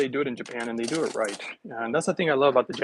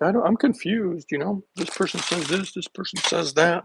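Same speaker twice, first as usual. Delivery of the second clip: confused people; 5 semitones higher than usual. Retold or imitated. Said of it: "They're like".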